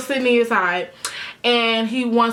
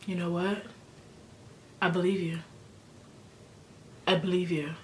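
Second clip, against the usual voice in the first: soft belief; 3 semitones lower, 11 dB quieter than usual. Retold or imitated. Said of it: "Peter goes".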